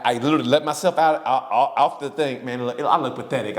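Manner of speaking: ordinary talk, nothing marked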